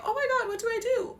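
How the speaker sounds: high, panicked cry. Retold or imitated. Student left alone by the elder, panicking high. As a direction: sound lost and in a panic. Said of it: "they're like".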